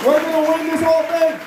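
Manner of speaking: high, loud victory shout; rally the crowd at full volume; heroic rallying shout. Real, not acted.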